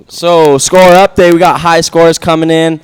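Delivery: loud high score announcement; laid-back surfer announcer. Real, not acted. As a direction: call the scores over the PA. Announces score update over PA.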